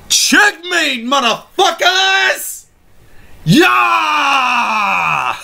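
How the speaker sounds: high triumphant shout; triumphant gloat. Real, not acted. Vlogger gloating at viewers after winning the argument, high shout. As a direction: crow over the win.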